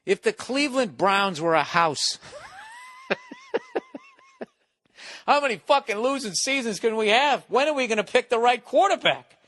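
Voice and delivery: high-pitched, fed-up fan complaint; worked-up sports-fan whine; exasperated rant. Acted. Exasperated rant comparing house to Browns.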